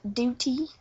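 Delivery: slow "duty" with a firm T; emphasized T. Shown as a demonstration. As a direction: tap the T hard in "duty". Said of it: "put more emphasis into the T".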